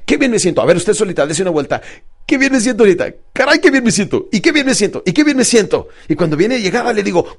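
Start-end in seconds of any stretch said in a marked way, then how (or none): 0.1-0.6 confident affirmation. Shown as a demonstration. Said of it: "con la barbilla levantada y diga". 2.3-5.9 high, buoyant repeated exclamations; transformed, upbeat voice. Retold or imitated. Client walking alone for her coach, elated. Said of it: "ya le cambió su voz".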